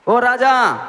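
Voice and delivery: ओ-style call, high and loud to the king; defiant cry. Acted. The faithful youths answering the king, loud defiant call.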